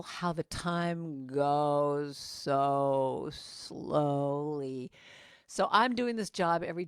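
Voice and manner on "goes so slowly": very slow, stretched words; drawn-out slowly. Acted. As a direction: let each word drag like the hours. Miserable time dragging on.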